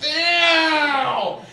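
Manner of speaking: loud, high yelp of pain; shout of pain. Retold or imitated. Comic on the toilet with a blown knee, loud yelp. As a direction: yelp like the knee just gave. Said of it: "I'm just like".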